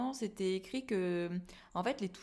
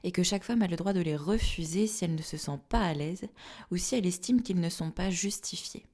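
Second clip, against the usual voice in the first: louder, steady scripted outro; formal read statement; 7 dB louder than usual. Acted. Closing statement read to listeners.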